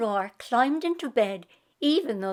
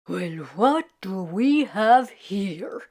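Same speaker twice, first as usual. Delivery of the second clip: curious lazy Irishman; 4 semitones lower, slower than usual. Acted. Jamie eyeing the jug.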